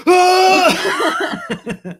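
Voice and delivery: high, loud delighted shriek with a laugh; excited shriek. Retold or imitated. Friend realising the baby, loud happy shriek. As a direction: shriek with joy at the news. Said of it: "he's like".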